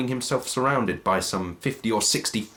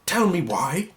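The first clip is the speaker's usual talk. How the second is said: a hint of a cowed old man, higher; lightly voiced Scrooge; 8 semitones higher than usual. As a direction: concede it nervously. Scrooge asks the ghost.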